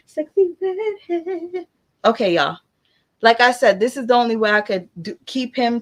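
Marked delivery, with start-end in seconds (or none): 0.1-1.7 "redhead" drawn out slow and very high; sing-song high. Shown as a demonstration. Mother repeating a viewer's name in a slow, high sing-song.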